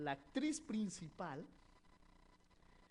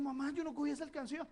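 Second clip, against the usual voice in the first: put-on pleading child; 10 semitones higher, 8 dB louder, faster than usual. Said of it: "Yo le digo".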